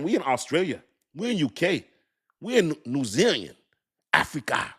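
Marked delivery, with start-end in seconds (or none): 4.1-4.7 playful put-on voice on "Africa"; "Africa" in a jokey character voice. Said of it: "why I made that voice".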